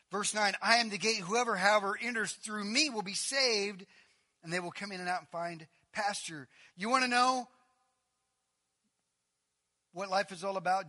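loud, high preaching read; loud scripture proclamation. Acted. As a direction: proclaim the verse to the hall. Reading scripture loudly.

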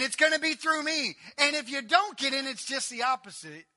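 loud, high, building preaching cry; full-force preaching. Acted. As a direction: rise to a shout of conviction. Jesus's words, preached loudly.

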